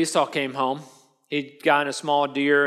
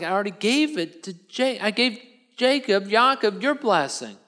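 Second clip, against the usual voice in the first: higher, halting protest of regret; mildly anguished acted reply; distraught father; 5 semitones higher than usual. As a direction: act out an upset father explaining helplessly. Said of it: "they're both crying".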